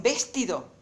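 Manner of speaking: VESTIDO stressed on its first syllable, VES-ti-do; misplaced stress on the VES of VESTIDO; wrong first-syllable stress. Shown as a demonstration. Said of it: "acentuarla mal como hago yo".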